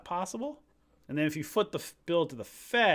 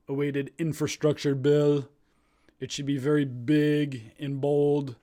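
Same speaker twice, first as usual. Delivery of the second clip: Trump voice; 5 dB louder than usual. Acted. Streamer reading the tweet to viewers as a light Trump voice. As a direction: read the tweet as a hint of Donald Trump. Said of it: "I'll do it in my Trump voice".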